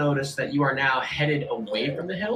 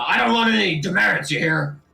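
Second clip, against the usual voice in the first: loud, only moderately raised character voice; corrected captain voice; 4 semitones higher, 5 dB louder than usual. Acted. Captain warning inspector about demerits, insistent. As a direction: gruffer retake of the nervous captain, less high. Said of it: "Sorry, that's the wrong voice".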